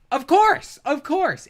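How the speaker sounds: loud, high-pitched insistence; exasperated outburst. Real, not acted. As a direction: cry it out as blindingly obvious. Exasperated outburst at opponent's argument.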